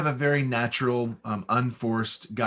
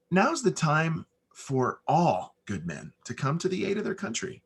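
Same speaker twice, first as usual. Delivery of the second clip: just say it to the person next to you; the right way, natural and unread; conversational; 4 semitones higher than usual. Shown as a demonstration. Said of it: "normally how you and I would talk every single day".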